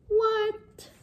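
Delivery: high squeaky what; shocked, voice shooting up; surprised. Acted. Reader shocked.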